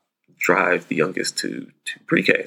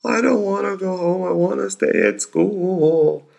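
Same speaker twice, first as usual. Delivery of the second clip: imitating crying child; 12 semitones higher than usual. Dad imitating his crying son begging to stay at school, high wailing voice. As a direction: mimic a four-year-old sobbing to stay. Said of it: "And he's like".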